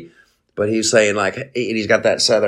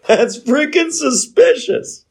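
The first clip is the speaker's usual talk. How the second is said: much higher, slower, squeaky laughing take; laughing, high-pitched mock disbelief; laughing repeat. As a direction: repeat it cracking up, voice shooting way up. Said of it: "That's freaking suspicious, bro".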